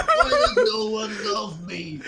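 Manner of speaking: high, loud, laughing sob of a lonely loser; mock crying wail. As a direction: wail it out self-pitying, half laughing. Lonely Soprano voiced crying.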